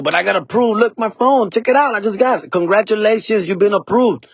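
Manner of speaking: proud and pushy, waving the approval; mock-excited customer showing his phone to the clerk; eager insisting.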